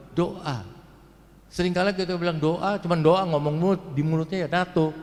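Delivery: ordinary talk, nothing marked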